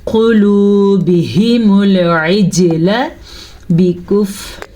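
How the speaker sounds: slow melodic tajweed recitation; Quran recitation. Shown as a demonstration. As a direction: draw the vowels out like a Quran reciter. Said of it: "پھر سے سنیں".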